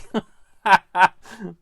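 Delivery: loud, high-pitched cackling laugh; real shrieking fit of laughter; helpless laugh. Real, not acted. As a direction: burst out laughing uncontrollably. Helpless laughing fit at own mistake.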